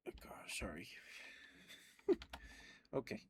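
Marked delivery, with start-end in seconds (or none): none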